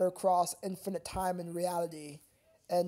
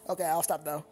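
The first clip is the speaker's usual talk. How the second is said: laughing; 4 semitones lower, faster than usual. Real, not acted. Laughing after the bit.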